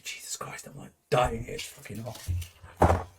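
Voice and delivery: breathy whisper, worn out by the heat; whispered complaint. Real, not acted. Co-host whispers to himself about the heat, in a hushed voice. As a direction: whisper it aside, wilting from the heat.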